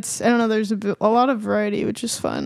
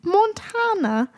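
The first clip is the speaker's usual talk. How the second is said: higher, slow and halting disbelief; mock bafflement; 6 semitones higher, slower than usual. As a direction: question it in a raised character voice. Baffled co-host in character.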